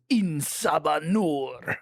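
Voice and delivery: slow, drawn-out villain name; stretched, imitated cartoon proclamation; grandiose name reveal. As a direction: intone the name with mock grandeur. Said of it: "Every time they say Apocalypse's name".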